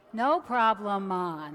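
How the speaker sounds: light Jamaican lilt on the saying; a hint of a Jamaican accent, easy and loud. Preacher quoting the Jamaican saying, light Jamaican accent. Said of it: "there's a saying".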